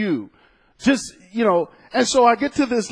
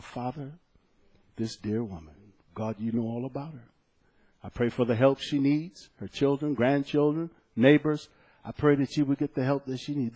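softer, tender prayer over a grieving woman; quiet prayer; 5 semitones lower, 6 dB quieter than usual. Retold or imitated. Preacher praying for a crying old lady, soft and tender. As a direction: pray gently with a hand on her shoulder. Prays over weeping customer.

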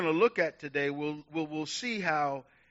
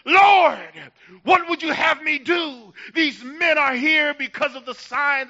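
higher and louder, stretched, fervent preaching; full-force preaching; 6 semitones higher, 9 dB louder than usual. Acted. Pastor urging his church to cry out, preaching at full force. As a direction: lift the church with rising fervour.

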